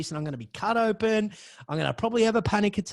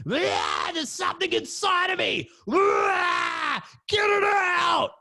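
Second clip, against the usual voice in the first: tripping guy screaming; 12 semitones higher, slower than usual. Retold or imitated. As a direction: scream like something's inside you. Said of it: "guy flipping out".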